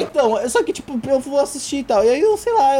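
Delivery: usual speaking voice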